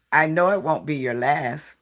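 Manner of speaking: ordinary delivery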